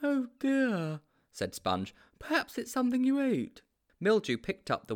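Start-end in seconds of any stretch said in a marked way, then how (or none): 0.0-1.0 high-pitched, naive sidekick; high storybook character voice; Sponge's character voice. A narrator's reading. 2.2-3.6 high-pitched, naive sidekick; high storybook character voice; Sponge's character voice. A narrator's reading.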